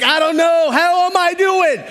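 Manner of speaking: hammed-up panicked whine; freaking out. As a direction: squeak it like you're losing it. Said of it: "Freaking out".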